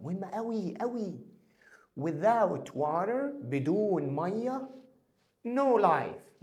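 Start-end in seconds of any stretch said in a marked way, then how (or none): 5.5-6.4 No life called out loud, punching No; emphatic No life. Shown as a demonstration. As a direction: press hard on No in No life. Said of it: "مفيش حياة". (لا حياة بصوت عالي مع ضغط على No)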